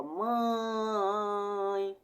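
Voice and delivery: "mãe" muito esticado, todo pelo nariz; nasal exagerado. Shown as a demonstration. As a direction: segure longamente o ãe nasal de "mãe". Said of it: "quando eu falo meio pro". ("mãe" numa demonstração nasal bem prolongada)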